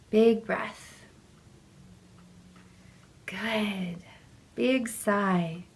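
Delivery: normal talking voice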